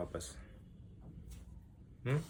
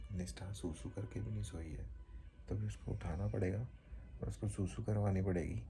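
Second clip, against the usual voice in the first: normal talking voice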